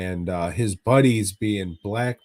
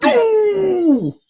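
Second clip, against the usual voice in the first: high mock death cry; 10 dB louder than usual. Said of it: "and then just goes".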